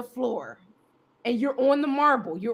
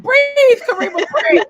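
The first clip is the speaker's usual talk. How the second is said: urging breathe; 10 semitones higher, 7 dB louder than usual. Retold or imitated. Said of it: "They're like".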